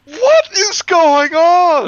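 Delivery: a drawn-out, squealing what is going on; incredulous slow high cry. Real, not acted. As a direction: stretch it out high and loud in disbelief. Disbelief at the story.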